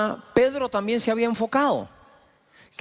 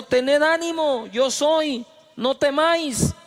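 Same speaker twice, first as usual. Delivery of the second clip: reassuring; 8 semitones higher, slower than usual. Acted. Jesus reassures the disciples.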